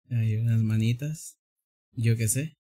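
ordinary delivery

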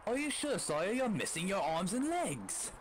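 high-pitched put-on British accent. Knight questioning his wounded sire, high mock British accent. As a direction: play a doubting squire in mock British accent. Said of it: "attempt to do my best British accent".

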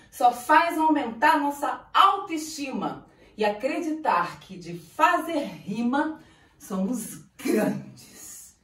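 normal talking voice

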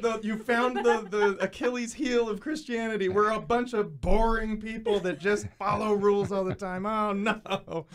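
high, loud mock dismay; mock panic. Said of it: "hear and go like".

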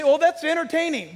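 high, loud breezy brush-off; mock flippant. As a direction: sneer at how shallow it sounds. Mocking a dismissive listener.

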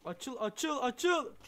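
urging it on, still holding back; urgent pleading. Real, not acted. Player urging a stuck door to open, urgent pleading.

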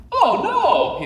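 high, loud alarmed Mario cry. Acted. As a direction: yelp it out as a panicked Mario. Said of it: "he thought".

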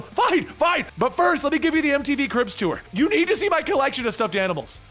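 high, eager chatter; excited showing-off. Acted. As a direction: give in, then show off excitedly. Wade eagerly offers a tour.

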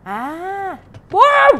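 very high, loud scared yell; startled shout. Real, not acted. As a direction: shriek at the scare. Jump scare in horror game. (teriakan kaget)

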